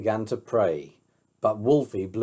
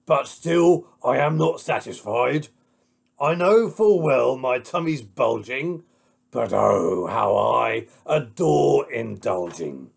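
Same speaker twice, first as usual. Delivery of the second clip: loud, greedy wolf voice; crying out as the wolf; 6 dB louder than usual. Acted. Greedy wolf boasts of his bulging tummy, loud and indulgent. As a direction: relish it like a gluttonous wolf. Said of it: "Wolf cried".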